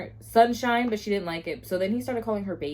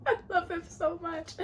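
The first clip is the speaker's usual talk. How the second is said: high, small, teary squeak; tearful gushing; 9 semitones higher, 5 dB quieter than usual. Real, not acted. Reader overcome by the hero's kindness, tearful gushing. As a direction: gush softly through a laughing near-sob. Gushing over the book's hero.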